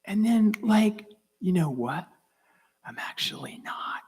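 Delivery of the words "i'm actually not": whispered realization. Real, not acted. Speaker realizing he is unafraid, whispering. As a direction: breathe it out as a discovery. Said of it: "quiet realization".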